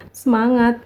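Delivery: ordinary delivery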